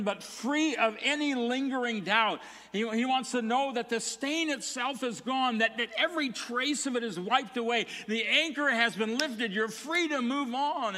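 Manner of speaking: high, loud, building sermon climax; a pastor pressing the congregation toward freedom; impassioned preaching. Real, not acted. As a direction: preach it out with rising urgency. Preaching builds to full force.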